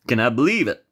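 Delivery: usual speaking voice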